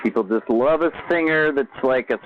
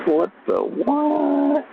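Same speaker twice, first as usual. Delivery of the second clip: drawn-out, much higher, very slow puzzled question; baffled; much slower than usual. Real, not acted. Confused at his own stream setup, slow baffled question. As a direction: stretch it out in disbelief.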